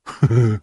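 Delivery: short giant's chuckle; pleased chuckle. A narrator's reading.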